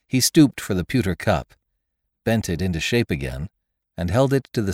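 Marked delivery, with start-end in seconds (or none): none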